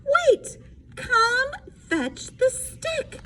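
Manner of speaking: high, slow calling after the dog; boy chasing his dog, calling high; Froggy calling. Acted. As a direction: plead with the dog to come back. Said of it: "called Froggy".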